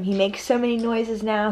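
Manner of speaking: normal talking voice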